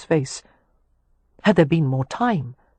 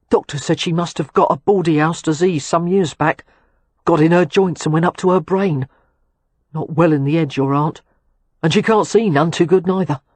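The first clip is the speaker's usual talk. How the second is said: put-on louder South London accent, eager; cockney lad glad to see her; 4 dB louder than usual. A narrator's reading. William speaking in his accent.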